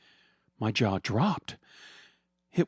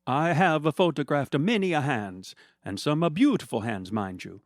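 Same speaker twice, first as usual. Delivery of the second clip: hammed-up Italian accent; 5 semitones higher than usual. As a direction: play a cartoonish Italian photographer. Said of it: "stereotypically and probably offensive accent".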